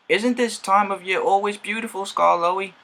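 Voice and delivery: loud, high call across to a friend; an engine yelling cheerfully to his friend nearby; yelled. A narrator's reading. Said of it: "he yelled".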